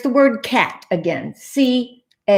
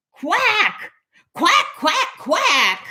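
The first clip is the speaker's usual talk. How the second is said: high-pitched quacks; frantic quacking; 11 semitones higher, much slower than usual. Acted. Cat quacks in exasperation at duck.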